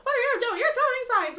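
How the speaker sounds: high, loud teasing protest; high-pitched mock scolding; mock alarm. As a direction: cry out in laughing mock shock. Mock alarm at co-host's hand signs.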